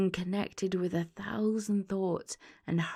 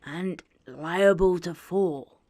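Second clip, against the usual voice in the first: remonstrating; 5 dB louder, slower than usual. A narrator's reading. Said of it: "Scrooge remonstrated".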